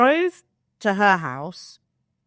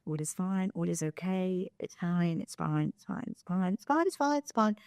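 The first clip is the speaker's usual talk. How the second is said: soft self-soothing mantra; 8 dB quieter than usual. Retold or imitated. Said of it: "I'm like".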